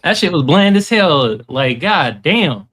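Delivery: loud, indignant roast; loud mock outrage. Real, not acted. [name] roasts the host's bland joke, loud mock outrage.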